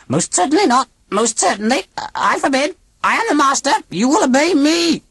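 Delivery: put-on high, stiff alien old lady; robot aunt voice. A narrator's reading. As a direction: speak like a cold machine aunt.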